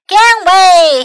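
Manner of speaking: high, loud warning call; loud cry. A narrator's reading. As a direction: call out to clear the path. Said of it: "he cried".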